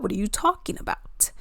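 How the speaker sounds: soft unvoiced whisper; whispered exasperation. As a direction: hiss it under your breath in disbelief.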